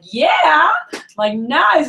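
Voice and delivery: high, loud cheer with a laugh; celebrating. Retold or imitated. Manager cheering a struggling kid's first sale. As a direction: hype up the rep's surprise sale. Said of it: "celebrate the shit out of it".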